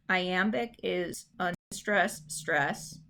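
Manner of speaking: slow, unstress then stress spoken out; demonstrating the rhythm. Shown as a demonstration. Teacher modelling iambic rhythm, slow and rhythmic. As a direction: tap out the beat in the words. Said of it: "You do need to know these".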